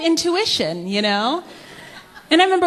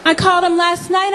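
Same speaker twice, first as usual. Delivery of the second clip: normal talking voice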